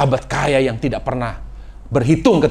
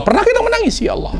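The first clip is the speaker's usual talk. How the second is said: much higher, emphatic question; sudden high cry; faster than usual. Real, not acted. Preacher urging the congregation to weep, sudden high cry.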